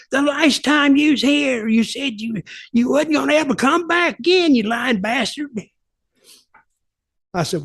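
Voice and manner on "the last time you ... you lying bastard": imitating an angry heckler. Heckler yelling through the fence, higher mock angry shout. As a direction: yell it like a furious fan calling him a liar.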